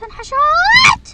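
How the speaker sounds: high, slow, loud cry of being cornered; panicked wail. Real, not acted. Gamer cornered in the game, panicked wail. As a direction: wail that you're trapped. Trapped by the granny.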